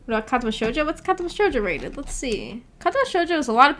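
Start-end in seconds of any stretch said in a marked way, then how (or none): none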